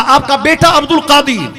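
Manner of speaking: high, loud call through a door; son calling at door. Retold or imitated. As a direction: announce yourself to your mother from outside. Son announces himself at door. (दरवाज़े से ऊँची आवाज़ में पुकार)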